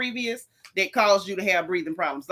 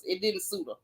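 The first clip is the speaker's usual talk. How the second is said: dropped softer, almost to herself; quiet aside; 2 semitones lower, 8 dB quieter than usual. Real, not acted. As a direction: say it under your breath, much softer. Repeats verdict on Kenya's hair.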